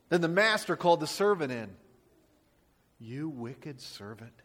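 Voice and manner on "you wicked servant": slow, soft, cold condemnation; low and slow, a judge passing sentence; stern rebuke. A narrator's reading. King rebuking the unforgiving servant, soft and stern. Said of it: "he said".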